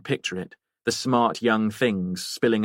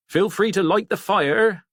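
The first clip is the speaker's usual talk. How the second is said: higher, slower, a bit louder landlord voice; landlord Harry; 11 semitones higher, 4 dB louder than usual. Acted. Harry offering Jen the fire, higher, slower and a bit louder. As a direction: lay it on as the obliging landlord, higher and drawn out.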